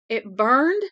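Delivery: can't believe what he's hearing; incredulous. Acted. Son asking his father about the fire, incredulous. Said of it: "Chuck's voice was incredulous".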